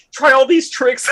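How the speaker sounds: high-pitched frantic plea; hammed-up frantic urging; mock frantic. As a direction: plead in a mock panic. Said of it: "it's like everyone frantically".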